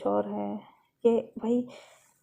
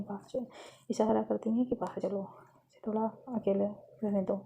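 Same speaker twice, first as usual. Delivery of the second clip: quiet motherly murmur; hushed urging; 6 dB quieter than usual. Acted. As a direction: discreetly usher someone out of the room. Mother signals sister to leave quietly.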